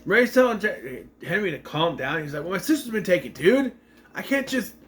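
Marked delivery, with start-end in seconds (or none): 2.4-4.7 retold protest climbing higher in pitch; mildly agitated protest. Henry protesting that his sister's been taken, mildly agitated. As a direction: imitate a worked-up brother, voice rising. Upset brother told to calm down.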